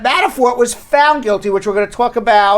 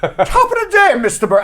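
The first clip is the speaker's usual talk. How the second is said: high-pitched mock British greeting; bad British accent; 11 semitones higher than usual. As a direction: imitate a British accent, badly. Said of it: "he comes in with his British accent".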